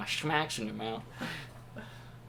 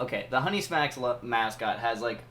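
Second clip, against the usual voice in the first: ordinary talk, nothing marked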